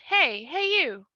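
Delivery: hey you, shouted across the deck; calling out. Acted. Young woman calling out to the nun on the ship. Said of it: "Someone says".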